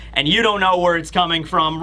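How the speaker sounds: normal talking voice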